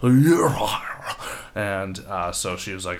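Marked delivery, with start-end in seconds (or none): 0.0-1.2 high, loud mock yell; retold shout. Supervisor sends worker packing in a rush, mock yell. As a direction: throw out a brief hammed-up holler.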